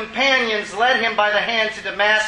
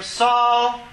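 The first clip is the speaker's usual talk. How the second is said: a higher, drawn-out call from heaven; heavenly voice calling; 5 semitones higher than usual. A narrator's reading. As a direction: call his name from above, raised. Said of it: "he heard a voice saying to him".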